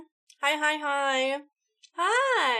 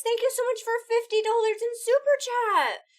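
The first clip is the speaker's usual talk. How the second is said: excited thanks; 8 semitones higher than usual. Real, not acted. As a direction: thank them with a thrilled shout. Big donation thanks.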